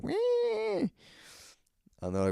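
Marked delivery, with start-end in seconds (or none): 0.0-0.9 very high, drawn-out squealed "wee"; mimicked heckler squeal. Drunk party starting up as comic speaks, mock squeal. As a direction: imitate a rowdy crowd's squeal. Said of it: "they start".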